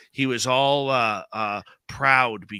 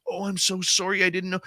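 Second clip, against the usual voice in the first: higher-pitched mock apology; apologetic; 6 semitones higher than usual. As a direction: blurt a retold, sympathetic sorry. Acted apology of shop girl.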